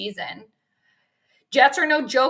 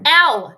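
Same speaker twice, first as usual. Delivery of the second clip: sharp ow from a burnt tongue; pained yelp; 4 semitones higher, 10 dB louder than usual. Real, not acted. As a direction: yelp in sudden pain. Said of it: "Ouch, I just burnt my tongue".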